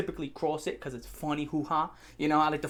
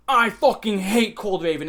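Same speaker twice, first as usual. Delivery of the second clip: loud, slow, emphatic hate rant; hammed-up loathing; mock hater; 3 semitones higher, 6 dB louder, slower than usual. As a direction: imitate an outraged video maker, leaning on each word. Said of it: "people make YouTube videos".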